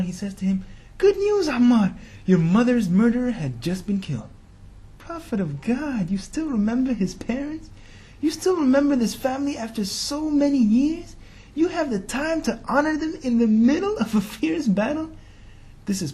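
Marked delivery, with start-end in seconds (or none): 1.0-4.3 joyful announcement. Acted. Prophet calls out joyful news. 5.0-15.1 rising, higher, marvelling questions; amazed wonder. Real, not acted. Preacher marvels at loyalty.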